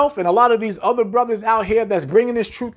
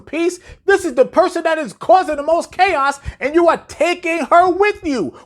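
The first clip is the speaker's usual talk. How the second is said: rising exasperation, pitched up and pushed; exasperated rant; 8 semitones higher than usual. Real, not acted. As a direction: berate them in disbelief. Ranting at men he scolds.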